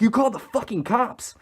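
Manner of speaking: high-pitched mock outrage; high, reenacted indignant outburst; retold panicked accusation. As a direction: imitate a paranoid friend's accusation. Retold friend pointing, accusing in panic.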